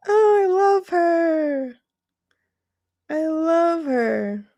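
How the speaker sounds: high, slow, choked-up words; tender, close to crying; teary love. Real, not acted. Streamer gushing about the donor, teary.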